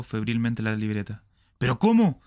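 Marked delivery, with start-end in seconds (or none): 1.6-2.2 sudden high, loud cry of alarm; shout. Acted. Smith cries out finding money missing, loud and high. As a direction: yell it out in shock. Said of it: "gritó".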